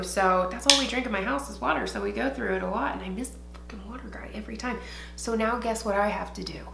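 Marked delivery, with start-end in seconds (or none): 2.9-4.8 fast whisper. Real, not acted. Complaining under breath.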